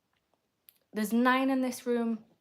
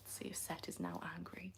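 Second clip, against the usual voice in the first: conspiratorial whisper aside; soft secretive whisper; 3 semitones lower, 17 dB quieter than usual. Real, not acted. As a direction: whisper it as if he might overhear. Aside about chatter Seth.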